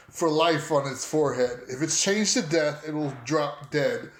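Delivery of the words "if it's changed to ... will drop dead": ordinary talk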